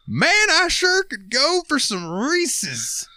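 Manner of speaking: very high, slow, dreamy candy craving; goofy customer voice. Retold or imitated. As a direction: drag it out like a spaced-out cartoon guy.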